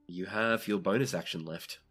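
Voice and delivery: ordinary talk, nothing marked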